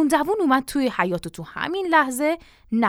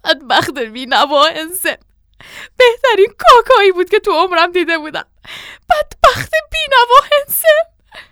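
tearful lament; 8 semitones higher, 8 dB louder than usual. A narrator's reading. Mother ignores father and goes on mourning, wailing. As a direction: keep wailing, climbing higher to the end.

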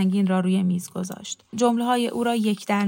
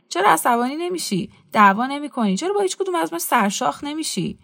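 lightly acted provocation, pitched higher; goading; 7 semitones higher than usual. A narrator's reading. Young man challenging his passive host to quarrel, goading. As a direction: mildly needle him with higher, insistent questions.

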